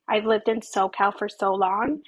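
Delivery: ordinary talk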